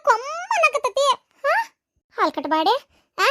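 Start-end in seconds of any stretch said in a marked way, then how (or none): none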